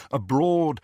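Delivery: usual speaking voice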